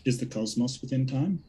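soft, distant from the microphone; faint voice from away from the mic; hard to hear. Real, not acted. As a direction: repeat the question faintly, off-mic. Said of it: "It's so hard to hear you".

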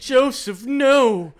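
high, loud mock-dramatic cry; acted yell. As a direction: shout in hammed-up despair. Acting out the character's yell.

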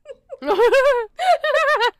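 high, loud burst of laughter; laughing. Real, not acted. Co-host amused by the chant, laughing. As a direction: crack up loudly.

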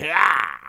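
loud, high maniacal "hah!"; hammed-up crazed yell, falling away; mad scientist cry. Mad scientist caps his rant, maniacal cry. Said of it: "in typical mad scientist fashion".

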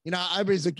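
ordinary talk, nothing marked

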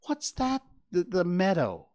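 the fawn presses his mother; insisting. A narrator's reading. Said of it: "Bambi insisted".

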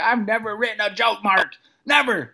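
high-pitched retold pleading; panicked protest. First-timer to fellow comedian before going on, high panicked protest.

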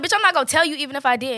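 loud, fast retort to the nurse; defiant shout; mock snap. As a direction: fire it back at her, fast and loud. Snapping at the nurse.

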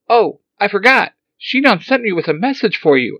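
high, loud frantic robot cry; excited robot. Acted. Alpha startled to see Zordon walking, high excited robot. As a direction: fret like a flustered little robot.

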